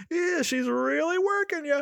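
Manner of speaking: very high, put-on voice with a laugh ahead of it; imitated haggling woman. Woman at checkout joking to the father, high mock-amused voice. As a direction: mimic her in a squeaky, jokey pitch. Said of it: "and she's like".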